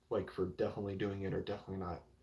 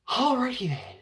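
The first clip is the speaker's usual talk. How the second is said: much higher and louder character voice; hammed-up silly character; put-on persona voice; 10 dB louder than usual. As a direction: pop into a goofy cartoon voice. Enters Rizzler persona.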